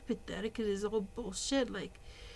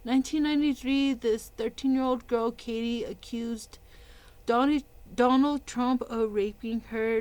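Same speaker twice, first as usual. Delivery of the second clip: louder, pointed reading of a news text; loud read-out of an article; reading aloud; 6 dB louder than usual. Real, not acted. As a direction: read it out like an accusation. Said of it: "And I'll read this out".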